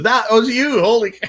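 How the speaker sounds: a friend yelping in surprise; mock amazed shout; retold astonishment. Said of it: "he just didn't even recognize it was me".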